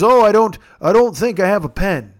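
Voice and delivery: put-on old-timer's voice; Officer Irwin. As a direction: hem and haw like a flustered grandpa. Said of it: "like you're a grandpa".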